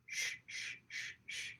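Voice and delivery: put-on soft breathy shushing of a baby; soothing shush. Imitating Ro shushing the fussing baby, soft shush. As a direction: shush gently as if rocking an infant. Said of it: "was Ro taking care of the baby by going".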